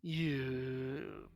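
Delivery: low, soft, looming monster voice; menacing low creature voice. Acted. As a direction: speak low and quietly like a cornered beast.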